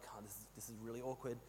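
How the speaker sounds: very soft, low mutter; awkward aside. Retold or imitated. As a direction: act out a private, uneasy thought. Retold awkward thought during silent prayer.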